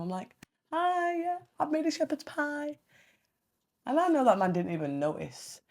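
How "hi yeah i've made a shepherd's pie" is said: high, soft sing-song greeting; sweet housewife act. Retold or imitated. Wife greeting husband with dinner, putting on sweetness. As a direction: put on a dainty, sweet little voice.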